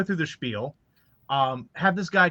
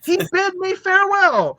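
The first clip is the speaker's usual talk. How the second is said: amused disbelief; 6 dB louder than usual. Real, not acted. Incredulous retelling, laughing.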